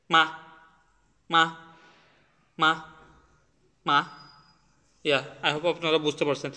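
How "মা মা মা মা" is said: short মা popping like a small bang; abrupt মা with no drawn-out pitch; toneless fifth tone. Shown as a demonstration. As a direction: burst out each মা, separate and brief. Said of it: "ঠাস করে একটা bomb ফুটলো".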